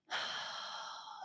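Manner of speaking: long, soft breath out; deep sigh. Shown as a demonstration. Nanny demonstrating a calming breath for a toddler, long sigh. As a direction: show the slow calming exhale. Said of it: "And then I go".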